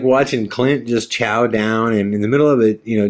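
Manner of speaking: normal talking voice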